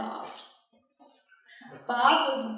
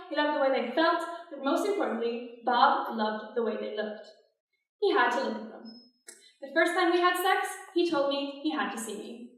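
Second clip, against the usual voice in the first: ordinary talk, nothing marked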